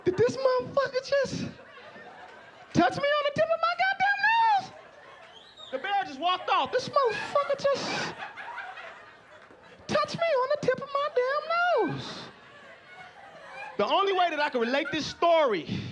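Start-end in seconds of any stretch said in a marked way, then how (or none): 0.0-4.7 very high falsetto disbelief; shocked bear. Retold or imitated. Bear was just touched on the nose, shocked falsetto. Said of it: "the bear was fucked up". 6.7-12.2 slow, very high falsetto; shocked bear. Retold or imitated. Bear walks off after being touched, shocked falsetto. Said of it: "was in shock".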